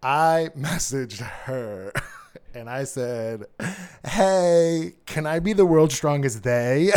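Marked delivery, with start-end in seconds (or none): 4.0-6.9 higher, louder playful come-on; cheeky message. Retold or imitated. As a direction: deliver the pun like a bold opener. Acts out own cheeky message.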